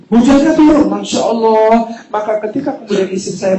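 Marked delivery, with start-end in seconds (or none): 0.1-1.9 amazed exclamation. Retold or imitated. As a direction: marvel out loud. Marvelling at the rain. (takjub, lebih keras)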